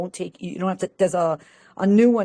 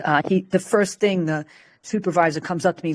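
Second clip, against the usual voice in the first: ordinary delivery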